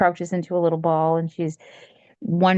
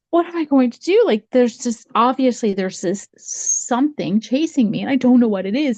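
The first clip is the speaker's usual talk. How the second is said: put-on higher-pitched worried girl; mild panic; 7 semitones higher than usual. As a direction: fret in a raised, acted voice. Girl chased by unknown thing.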